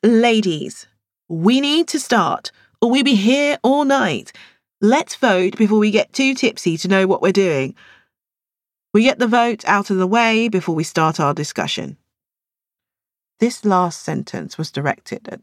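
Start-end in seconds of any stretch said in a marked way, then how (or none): 0.0-12.0 higher, firm call to order; refereeing host. A narrator's reading. Host calling the book club ladies to order, firm and higher. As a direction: take charge of the room. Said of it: "like a referee".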